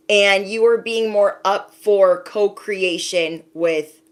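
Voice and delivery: ordinary talk, nothing marked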